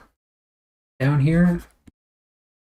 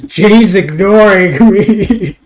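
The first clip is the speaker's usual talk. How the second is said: whiny complaint; 9 semitones higher, 14 dB louder, much slower than usual. Acted. Complaining Jay ignores him.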